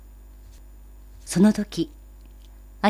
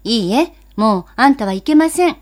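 firm mother; 2 semitones higher, 7 dB louder than usual. Acted. Mother refuses the boy.

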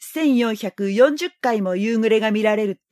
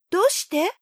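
put-on higher, curious little boy; young boy voice; 10 semitones higher than usual. A narrator's reading. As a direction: ask like a child. Prince asks.

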